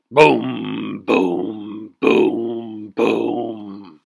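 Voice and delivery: loud booming stomps; giant footsteps. Acted. Giant father coming down the hall, loud booming footsteps. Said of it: "David's father sounded like this".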